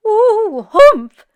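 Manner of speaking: loud, high ghostly ooh then humph; wail, then snort like a ghost; loud, high ghost grumble; ghost's humph. Acted. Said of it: "said the ghost".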